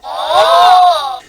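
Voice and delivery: high, loud delighted gasp; sharp high intake of breath; excited gasp. A narrator's reading. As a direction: gasp like a thrilled child. Gasp for Peppa.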